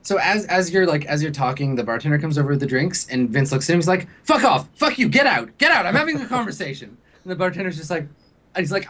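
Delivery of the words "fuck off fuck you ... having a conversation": higher-pitched angry dismissal; irritated outburst. Acted. Vince sends the bartender away mid-conversation, irritated outburst. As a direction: lash out at an intruder, in bursts.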